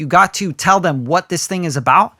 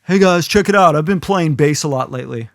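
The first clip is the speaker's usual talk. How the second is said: lame casual opener. Retold or imitated. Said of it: "go like".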